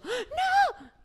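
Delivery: very high, startled cry; retold panicked shriek of a mother; grito de susto. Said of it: "yo pegaba un grito". (grito agudo de alarma)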